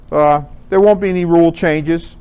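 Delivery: normal talking voice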